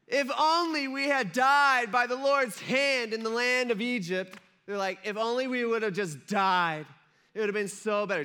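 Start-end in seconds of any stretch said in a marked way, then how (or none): 0.1-4.4 loud, high, drawn-out whining; really whiny. Acted. Grumbling Israelites to Moses in the wilderness, really whiny. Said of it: "in a really whiny voice". 5.0-8.2 mock whining, a little louder; whiny. Israelites wishing they had died in Egypt, mildly whiny. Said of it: "in a really whiny voice".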